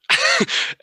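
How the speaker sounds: sudden high-pitched laugh; loud high laugh bursting out; burst of laughter. Real, not acted. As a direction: crack up loudly, high. Laughing at own story.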